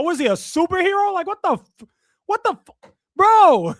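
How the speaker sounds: can't believe what he's hearing, voice climbing; incredulous outburst. Real, not acted. Streamer to his chat, in rising incredulous shock.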